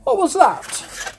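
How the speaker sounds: high squeaky voice. Acted. Second voice questions him.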